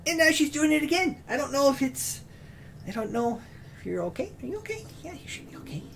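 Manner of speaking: high, soft cooing pet talk. Real, not acted. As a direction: coo softly to the cat. Cooing to cat on lap.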